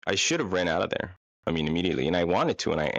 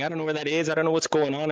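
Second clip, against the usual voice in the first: high-pitched mock panic; retold panicking; 8 semitones higher, faster than usual. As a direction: imitate her panicking, voice pitched up. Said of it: "She starts panicking".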